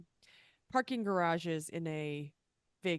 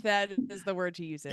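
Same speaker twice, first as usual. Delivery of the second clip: normal talking voice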